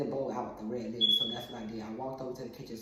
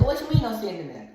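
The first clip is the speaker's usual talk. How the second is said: loud, high, fast challenge; indignant mom; 8 semitones higher, 9 dB louder, faster than usual. Acted. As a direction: fire back in disbelief as an annoyed mother. Mother challenging the excuse.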